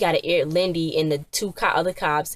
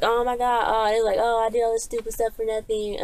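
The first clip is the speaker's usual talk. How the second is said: whiny regret; 6 semitones higher than usual. Retold or imitated. Greedy people realise loss.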